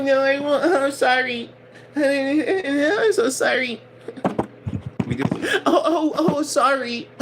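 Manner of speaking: mock a crybaby begging forgiveness; sarcastic impression of a fake victim sobbing sorry; fake teary apology. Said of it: "It's that fake, vulnerable, fake".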